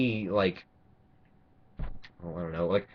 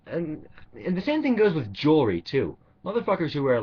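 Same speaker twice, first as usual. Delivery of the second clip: ordinary talk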